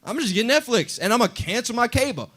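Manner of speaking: a viewer thrilled to drop cable; eager customer. Retold or imitated. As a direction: sell yourself on the bargain. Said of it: "people were like".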